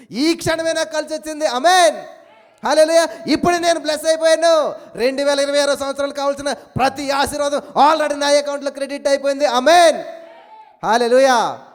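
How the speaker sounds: loud, high declaration; preacher lifting the crowd; fervent preaching. Real, not acted. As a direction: proclaim it with full force.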